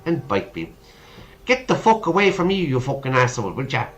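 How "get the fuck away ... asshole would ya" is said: put-on a light Irish accent, cursing fast and high. Girl curses at the Frenchman in an Irish accent. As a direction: spit the curse out in a thin Irish lilt. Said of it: "curse in a thick Irish accent".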